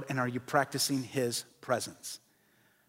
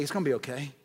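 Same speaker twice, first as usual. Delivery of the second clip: much softer, tender reassurance; hushed, tender voice of comfort; reassuring God voice; 4 dB quieter than usual. Retold or imitated. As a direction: comfort them gently, as God. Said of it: "he's like".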